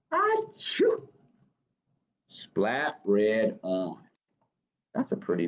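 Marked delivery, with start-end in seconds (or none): 0.1-1.1 very high squeaky achoo sneeze; cartoon sneeze. Reading aloud, the mouse sneezes, squeaky high sneeze. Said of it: "he sneezed and said".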